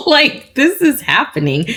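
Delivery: high laugh spilling into the words; laugh, then blurt it high in disbelief; giddy, high-pitched and amused. Real, not acted. Giddy disbelief about her coming trip.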